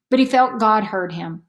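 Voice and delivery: usual speaking voice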